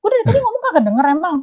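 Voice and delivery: high, fast exasperated comeback; high quick comeback to a lying debtor; annoyed retort. Retold or imitated. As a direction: throw his excuse back at him. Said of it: "gue kesel dong".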